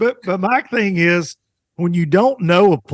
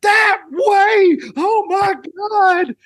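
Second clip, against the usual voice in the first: panicked marshal; much slower than usual. Retold or imitated. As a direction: scream it in terror from the passenger seat. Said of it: "he goes".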